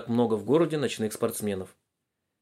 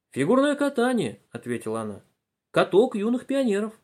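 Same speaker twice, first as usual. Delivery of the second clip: высокий ответ бабушки; 9 semitones higher than usual. A narrator's reading. Бабушка отвечает.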